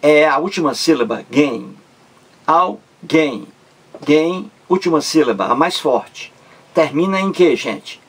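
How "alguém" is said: "Alguém" split as al, guém; syllable by syllable. Shown as a demonstration. Teacher pointing out the last syllable, "Alguém" in two separate beats. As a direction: pause between "Al" and "guém".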